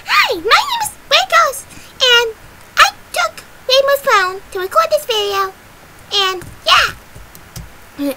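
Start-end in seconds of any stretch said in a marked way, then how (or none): 0.0-6.9 high, loud squeaky character voice; shrill squeaky introduction; Sprinkles. Shown as a demonstration. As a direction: squeak it out brightly as a little cartoon character. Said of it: "use such a squeaky voice".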